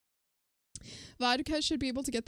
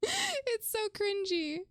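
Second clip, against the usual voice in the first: very high, drawn-out whine; cringing squeal; 11 semitones higher, slower than usual. Real, not acted. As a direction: squirm through it, high and slow. Squirming at something cringy.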